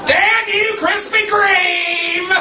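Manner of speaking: drawn-out high shout; slow, high hammed-up outcry. Mock curse at donuts.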